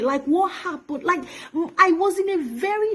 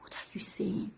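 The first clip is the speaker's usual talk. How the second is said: whisper; 14 dB quieter than usual. Real, not acted. Disbelief, repeated question.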